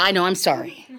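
ordinary delivery

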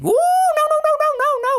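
very high, loud chattering monkey. Acted. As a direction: babble it like a tricky monkey. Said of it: "said the monkey".

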